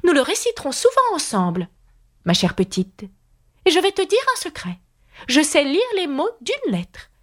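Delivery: high, sing-song queen, a little quick. A narrator's reading. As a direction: lift it high like a doting old queen.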